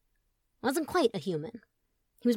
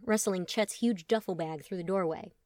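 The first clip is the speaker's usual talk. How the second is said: plain reading voice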